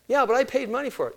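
put-on outraged teen. Teenage Dan arguing with his dad, indignant. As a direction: argue back hotly. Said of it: "Kid went crazy".

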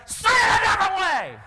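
high-pitched, strained mock yell; dad's exasperated shout. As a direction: act out a parent blowing up at a child. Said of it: "I would be more like".

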